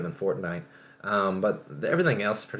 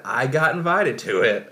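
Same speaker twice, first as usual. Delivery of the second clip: bursting with the news, louder and higher; excited reveal; 6 semitones higher, 7 dB louder than usual. Real, not acted. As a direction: brag that you got in. Proud reveal after "guess what.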